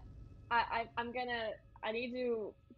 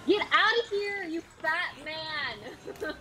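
taunting yell; 6 semitones higher, slower than usual. Real, not acted. Yelling at monster.

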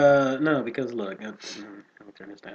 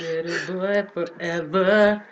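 put-on high, slow sing-song little boy; Peter Pan voice; 7 semitones higher, 8 dB louder, slower than usual. Gamer mimics Peter Pan to his co-host, high and slow. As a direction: drag it out like a boy who never grows up. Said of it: "Peter Pan".

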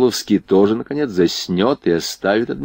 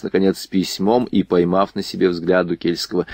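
ordinary delivery

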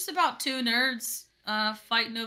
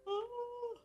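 yawning; 14 semitones higher, 9 dB quieter than usual. Real, not acted. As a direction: yawn drawn out. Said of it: "I yawned".

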